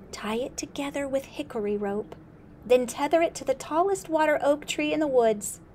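a hint of an urgent little frog; frog prophet. Spotted Frog foretells the flood.

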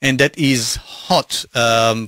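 ordinary talk

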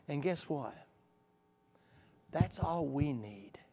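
soft, slow, intimate closing words; tender hushed preaching. Acted. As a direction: draw the congregation close, gently. Tender hushed sermon close.

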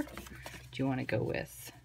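slow whisper, thinking aloud; a slow whispered aside to herself; whispered to self. Real, not acted. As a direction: whisper it slowly as you mull it over. Repeats question softly to self.

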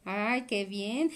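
ordinary delivery

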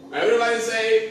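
loud, fast call to the crowd. Real, not acted. Calling out to the kids.

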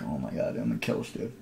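a breathy under-the-breath aside; mock murderous whisper of annoyance; muttered aside. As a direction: hiss it to yourself, half-joking. Retold inner thought, aside.

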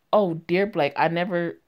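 usual speaking voice

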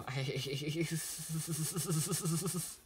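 long high stuttering cackle; badly done, hammed-up villain-like laugh; terrible laugh. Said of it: "that was a terrible laugh".

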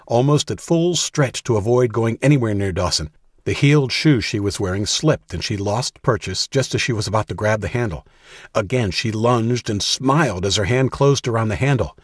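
ordinary delivery

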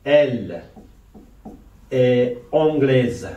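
slow French "anglaise" ending in a voiced z; spaced out slowly, "anglaise" closing on z; final consonant pronounced. Shown as a demonstration. Teacher giving the feminine example slowly, "anglaise" with the final z sounded.

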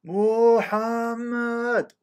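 long drawn-out call up to the flats; high, slow calling-out; shouting up a name. Retold or imitated. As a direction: call up to the top floor, stretching the name. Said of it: "he shouts again".

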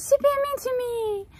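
very high whiny pet voice; high tattling dog voice; voicing the dog. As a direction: whine it up high as the wronged dog. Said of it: "He says".

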